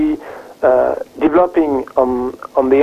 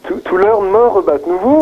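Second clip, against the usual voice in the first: put-on higher, playful French accent; 6 semitones higher than usual. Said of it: "tell us in a French accent".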